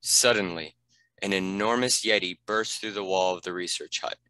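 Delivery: ordinary delivery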